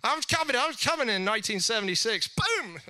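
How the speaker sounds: high, loud burst of glee; excited. Acted. Pastor thrilled at being born, jubilant cry. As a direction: shout it out like launching into life. Excited about coming to earth.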